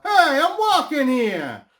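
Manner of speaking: loud, high, drawn-out Brooklyn shout; Brooklyn accent. Shown as a demonstration. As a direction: yell it as an indignant movie Brooklynite. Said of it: "the typical Brooklyn accent is like".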